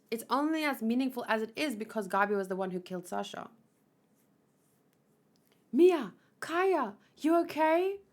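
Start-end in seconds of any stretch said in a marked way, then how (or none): 5.7-8.0 high, loud call of concern; anxious calling out. Acted. Finding Mia and Kaya after the attack, calling anxiously. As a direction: call out to check on them.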